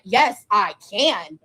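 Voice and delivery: slow, deliberate, defiant; stubborn insistence. Retold or imitated. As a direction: spell each word out like a final verdict. Retold insisting to nurse.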